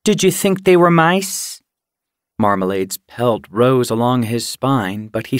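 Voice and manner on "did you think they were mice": high, scornful question; withering scorn. A narrator's reading. Red belittles Marmalade's catch, withering scorn. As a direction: ask it as a cutting put-down. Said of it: "met his gaze with a withering look".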